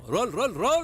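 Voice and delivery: high, loud repeated call with a laugh; shouting roll. Real, not acted. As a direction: yell roll three times across the set. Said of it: "role, role అని అరవకండి sir".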